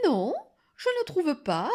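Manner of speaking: high, sweetly put-on niceness; extra-kind voice. A narrator's reading. Wolf contradicts the girl, in a sugary voice. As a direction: disagree ever so nicely. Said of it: "d'une voix encore plus gentille".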